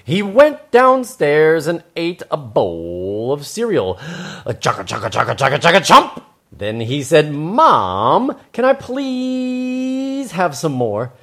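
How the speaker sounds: slow, stretched words with big swoops; animated children's storytelling. Acted. Host reads to children, exaggerated storytelling. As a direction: chomp through the eating noises, loud and rising.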